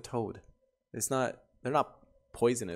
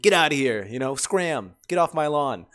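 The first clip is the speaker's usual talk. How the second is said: loud, higher, hammed-up get-off-my-lawn yell; playfully yelling her off the property; mock yelling; 4 semitones higher, 7 dB louder than usual. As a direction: shoo her away like a cranky old man. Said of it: "You're like".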